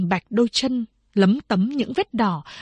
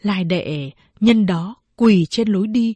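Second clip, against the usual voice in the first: ordinary delivery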